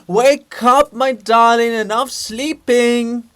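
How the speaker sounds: loud, high, drawn-out wake-up call; sing-song to rouse a sleeper, slowing at the end; mock sweet wake-up. Hrushevsky rousing the snoring Shevchenko, loud and sing-song. Loudly waking the sleeping Kobzar.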